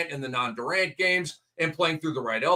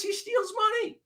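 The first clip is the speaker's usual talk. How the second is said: a high, put-on voice for a player cashing in; mock the player's greed in a high voice; mock greedy voice. Mocking Ayton's attitude.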